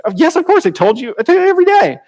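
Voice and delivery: high, quick, mock-breezy yes of course; breezy confident reply. Husband brushing off his wife's question, breezy. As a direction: toss it off like it's obvious. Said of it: "He was".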